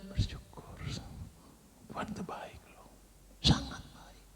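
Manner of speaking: soft, voiceless whisper. Shown as a demonstration. Preacher mocking silent thanks. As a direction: thank God with no voice at all. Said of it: "Gak ada suaranya".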